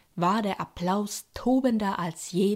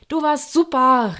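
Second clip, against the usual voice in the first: higher, louder excited shout; excited call; 6 semitones higher, 7 dB louder than usual. Acted. Little hyena brother cheering his sister, excited and loud. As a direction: cheer her on with delight. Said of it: "rief er begeistert".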